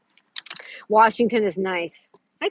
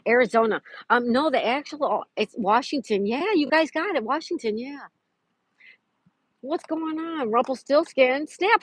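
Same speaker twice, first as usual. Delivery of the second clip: normal talking voice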